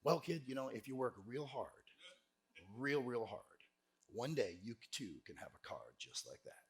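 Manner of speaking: low, hushed, earnest sales pitch; grave, quiet advice with long pauses; dead serious. Retold or imitated. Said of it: "I looked dead in his face".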